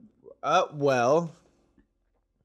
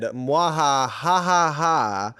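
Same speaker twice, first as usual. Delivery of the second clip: mustache twirling villain; 2 semitones lower, slower than usual. Retold or imitated. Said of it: "and I said".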